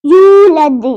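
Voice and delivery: loud यूलद with the yoo held; lengthened vowel. Shown as a demonstration. Teacher models a one-alif length, long यूलद. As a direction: stretch the first vowel of यूलद, bounce the D. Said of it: "यू को एक अलिफ के बराबर लंबा करेंगे".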